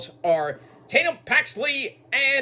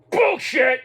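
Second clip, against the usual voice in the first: a sudden loud protest; loud outburst; 7 semitones higher, 5 dB louder than usual. Real, not acted. To the screen about her claim, a loud outburst. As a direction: shout it out in disbelief.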